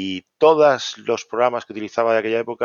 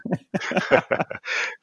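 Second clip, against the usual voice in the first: breathy laugh; 9 semitones higher than usual. Real, not acted. Guest reacting to the host's joke, airy laugh. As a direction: let out a quick breathy laugh.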